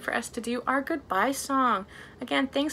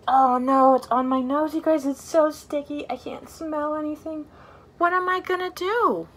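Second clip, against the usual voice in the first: hammed-up alarm, louder; mock panic; 4 semitones higher, 5 dB louder than usual. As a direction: play at being stuck for the kids. Pretend panic, gum on nose.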